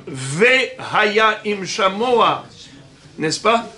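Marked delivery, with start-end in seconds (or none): 0.1-2.5 "Vehaya" with a clear slow "ve"; "Vehaya Im Shamoa" slowly, opening on a full "vé"; pronounced Sheva. Shown as a demonstration. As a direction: sound the vowel after V in "Vehaya", spacing the words out. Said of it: "le Sheva se prononce comme".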